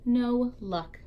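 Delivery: plain reading voice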